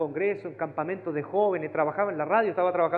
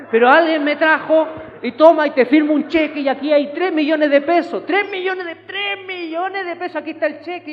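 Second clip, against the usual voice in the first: much higher, loud, excited shout; shouted mock miracle; 10 semitones higher, 12 dB louder than usual. Preacher mimics a stranger handing over a cheque, shouting high. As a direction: belt out the miracle like a revival. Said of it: "así una cosa así como".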